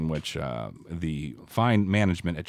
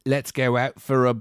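lightly put-on Australian accent, a bit higher; mock Aussie quote; 4 semitones higher than usual. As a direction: toss it off like a cheesy Aussie ad. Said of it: "one quote they forgot to put at the end".

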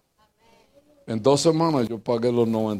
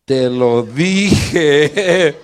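mock told-you-so; 7 dB louder, much slower than usual. Wife scolding injured husband.

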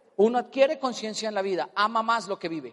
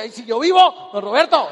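ay burlón, fuerte; búrlate imitando su queja; burla; 10 dB louder than usual. Retold or imitated. (burlándose de don Roberto, imitándolo fuerte)

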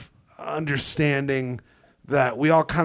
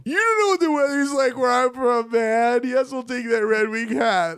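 imitating weepy self; 8 semitones higher than usual. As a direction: play his own tearful drunk self. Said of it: "That's what I did".

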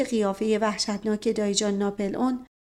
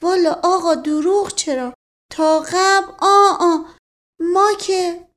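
higher, louder village servant chatter; higher-pitched folksy servant voice; rustic servant voice; 8 semitones higher, 6 dB louder than usual. Acted. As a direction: play the garrulous old servant. Mash Qasem's voice, stammering.

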